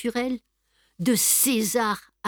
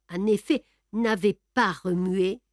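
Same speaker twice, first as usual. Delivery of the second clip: ordinary delivery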